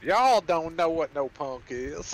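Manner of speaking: slow, high, loud country twang. Acted. Player doubling down on the punk taunt, country twang. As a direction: lay on a drawling country twang. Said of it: "He says in a country twang".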